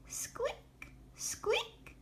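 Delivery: tiny, very high, soft squeaks; nervous mouse. A narrator's reading. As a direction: squeak the word like a timid little mouse. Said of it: "said Mouse nervously".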